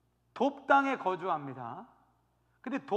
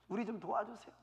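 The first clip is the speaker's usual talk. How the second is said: hushed, whispered plea; acted meek appeal for help; pleading; 7 dB quieter than usual. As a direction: beg softly under your breath. Elders begging Jephthah for help.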